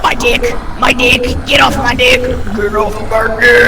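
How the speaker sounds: loud frantic yell; panicked yelling. Real, not acted. Attacked by the snail, panicked yelling. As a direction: scream for it to get off.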